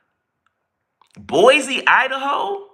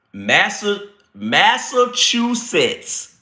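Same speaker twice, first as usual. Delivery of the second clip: Massachusetts drawn out syllable by syllable, pitched up; slow, careful pronunciation; 7 semitones higher, much slower than usual. Real, not acted. Streamer struggling with a word he could never say, slow and careful. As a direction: take the tricky word very slowly. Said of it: "I have to say it slow to say it right".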